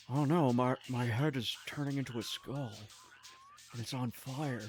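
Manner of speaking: low, hushed film-star impression; Nicolas Cage. Streamer mocking an actor's film roles, low hushed impression. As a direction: drop deep and soft, mock-dramatic. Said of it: "I sound nothing like Nicolas Cage".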